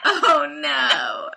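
loud, high cry of dismay. Real, not acted. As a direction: cry out in horror. Reacting to bad picture.

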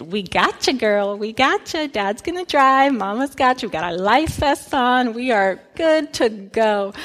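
put-on calm a nervous little girl; reassuring mom. Mom on the tube reassuring her nervous daughter, soothing.

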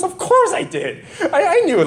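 high-pitched, laughing through the words; a mother chuckling at the obvious; laughing mom. Retold or imitated. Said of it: "she goes".

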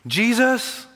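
high, loud pupil's answer; mock the eager Sunday-school reply. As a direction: pipe up high with the stock answer. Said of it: "he answered the right answer for Sunday schools".

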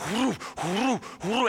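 soft whoo of rushing riders; imitated whooshing. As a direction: mimic horses sweeping past in quick whooshes. Imitates angel horsemen rushing up.

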